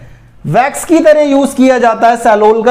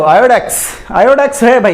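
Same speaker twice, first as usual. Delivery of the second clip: normal talking voice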